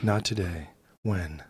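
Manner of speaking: low, quiet, deadpan voice of despair; gloomy goth woman. Retold or imitated. Imitating the goth lady on the subway, low and quiet despair. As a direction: drone it out like she's given up on life. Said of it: "She's like".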